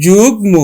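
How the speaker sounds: যুগ্ম with g and m both sounded; correct pronunciation. Shown as a demonstration. Teacher correcting the reading, যুগ্ম as jug-mo. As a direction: keep the m audible after g in যুগ্ম. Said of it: "এটা উচ্চারণ হবে কিন্তু".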